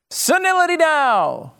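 high, drawn-out mock yell; yelled parody catchphrase. Host spoofing a Seinfeld catchphrase for Biden, yelled. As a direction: holler it like a fuming sitcom dad. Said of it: "we sort of modified that for Joe Biden".

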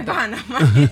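laughing through the words. Real, not acted. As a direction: break up laughing mid-sentence. Laughing at the commercial.

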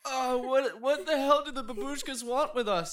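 high, loud, flustered question; agitated panic. Acted. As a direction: demand it in a panic. Panicking after being gagged.

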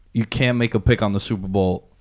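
ordinary talk

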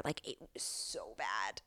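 breathy whispered aside, barely voiced. Real, not acted. Telling friends the shameful part, whispered. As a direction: confide it in a hushed whisper. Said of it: "whispered aside".

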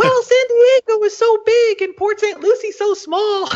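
put-on very high-pitched, babyish whine; whining baby. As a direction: whine it out like a complaining little baby. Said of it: "whining like a little baby".

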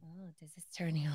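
breathy teasing whisper; seductive whisper. Pretend. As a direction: whisper it suggestively, half joking. Teasing about sexy raspy voices.

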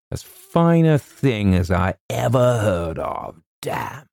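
admiring soldierly colonel. Acted. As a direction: savour the praise like an old soldier. Colonel's character voice.

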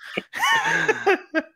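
bright high-pitched laugh. Real, not acted. Host laughing at co-host's accent joke, high laugh. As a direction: let a high laugh burst out.

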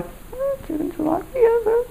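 very high, soft, savouring voice; mock swooning craving. Diner suddenly craving her companion's dish, mock swooning. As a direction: coo it high and quiet over the food.

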